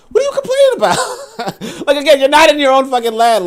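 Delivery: incredulous laughter breaking the words; laughing. Real, not acted. Mocking a complainer, laughing.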